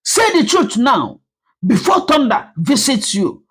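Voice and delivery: high, forceful shout at her. Acted. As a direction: yell at her to talk. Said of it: "Everyone shouted at once".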